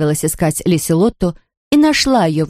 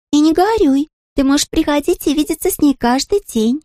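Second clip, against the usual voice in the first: put-on high child voice; 9 semitones higher than usual. Princess comforting.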